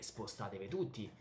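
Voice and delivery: normal talking voice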